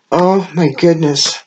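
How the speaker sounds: ordinary delivery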